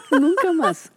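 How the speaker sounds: loud laughing high delivery. Real, not acted. Teacher laughing at the student's defeat, loud and high. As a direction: laugh through the punchline.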